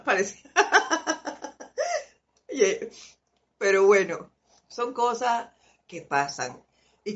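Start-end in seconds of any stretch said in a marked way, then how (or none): 0.5-2.0 a high real laugh; a bright rising laugh. Real, not acted. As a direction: laugh at the story. Laughs after the eyeglasses story.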